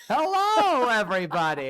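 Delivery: high, booming cartoon showman's greeting; John Mulaney. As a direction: holler it like an old New Yorker entertainer. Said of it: "like, John Mulaney type person".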